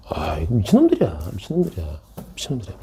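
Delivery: soft repeats trailing away to near nothing; fading aside. Real, not acted. Teacher reacting to his own students, fading soft aside.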